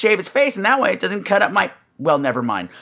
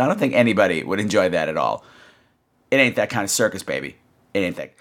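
sassy; 10 semitones lower than usual. Retold or imitated. Sassy reply to friend.

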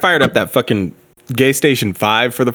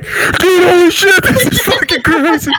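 excited; 3 dB louder than usual. Retold or imitated. Imagined awed listener reaction.

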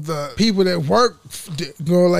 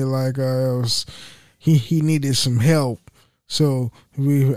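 normal talking voice

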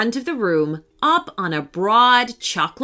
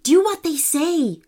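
higher, urgent plea; pressing, raised-pitch appeal; urging; 7 semitones higher than usual. A narrator's reading. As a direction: urge friends to give in. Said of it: "urged Jensen".

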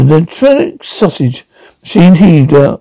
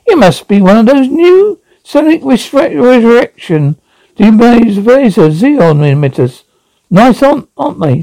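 chirpy high machine voice; bright, keen robot; eager high robot voice; 7 semitones higher than usual. Acted.

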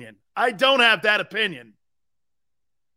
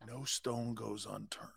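hushed, low mock reverence; mock fawning; 8 semitones lower, 16 dB quieter, slower than usual. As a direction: drop low and soft, mocking a gushing insider. Mocking fawning reporter's public praise.

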